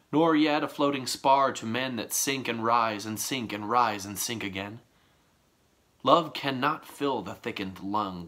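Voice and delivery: measured, deliberate sonnet reading; poem recital. Acted. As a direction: read each line as verse, pausing between. Reads the sonnet aloud.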